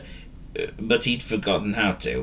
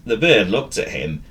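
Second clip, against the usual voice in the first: ordinary delivery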